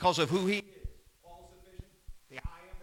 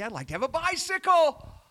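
put-on excited little girl; 10 semitones higher, 5 dB louder than usual. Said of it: "Sally says yes".

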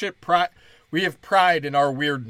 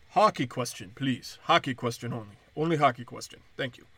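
mock Russian accent; 3 semitones lower than usual. Player deflecting questions, Russian voice.

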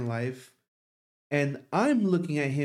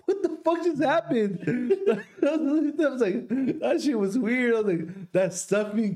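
high-pitched, laughing through the words; can barely get it out for laughing; real laughter cracking the voice up high; laughing hard; 8 semitones higher than usual. Real, not acted. Laughing while recalling the scare.

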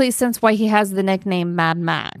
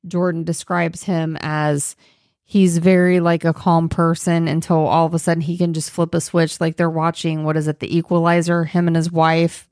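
normal talking voice

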